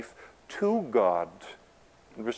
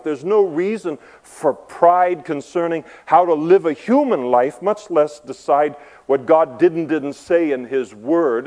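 louder, fired-up preaching; impassioned sermon rant, louder and higher; preaching at full force; 3 semitones higher, 6 dB louder than usual. Acted. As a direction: pound the pulpit with indignation.

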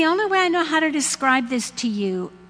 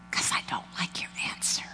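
rushed, softer whisper; whispered confession; 10 dB quieter, much faster than usual. Acted. Habakkuk whispering his complaint to God. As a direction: confide it under your breath.

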